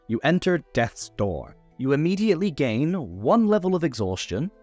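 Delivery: ordinary talk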